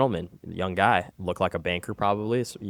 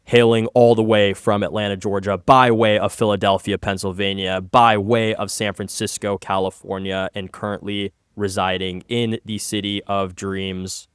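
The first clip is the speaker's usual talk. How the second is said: fight-night hype with stretched-out phrases; full-blown intro; 6 dB louder than usual. Pretend. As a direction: ring announcer introduction, loud and drawn out. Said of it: "you ready for this introduction?".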